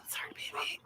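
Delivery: flat whispered robot voice. Pretend. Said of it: "Like, robot".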